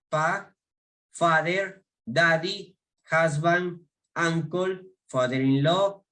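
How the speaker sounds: each English word said on its own, clear and lifted, for students to repeat; pronunciation drill. Shown as a demonstration. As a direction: model "grandpa", "father", "cousin" one by one, carefully. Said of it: "voy a darles la pronunciación". (lista de pronunciación, cada palabra clara y separada)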